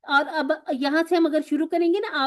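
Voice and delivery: normal talking voice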